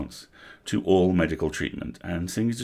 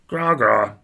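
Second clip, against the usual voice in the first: loud higher troll grunts waving it off; dismissive troll; 5 semitones higher, 7 dB louder than usual. Acted. Troll waving off the elf's worry, loud dismissive grunts. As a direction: brush it off like a big troll. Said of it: "waved a hoof-like hand dismissively".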